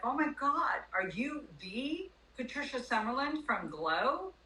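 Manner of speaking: high, excited mock gasp of recognition; starstruck recognition. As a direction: imitate an amazed fan, drawn out. Imitating broker recognizing a celebrity.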